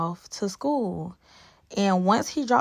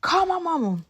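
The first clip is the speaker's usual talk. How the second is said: panicked plea; 9 semitones higher than usual. Retold or imitated. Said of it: "I was like".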